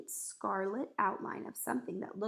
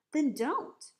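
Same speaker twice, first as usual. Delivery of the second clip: loud, higher retort; sharp answer; 5 semitones higher, 6 dB louder than usual. A narrator's reading. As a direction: snap it back sharply. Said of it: "answered sharply".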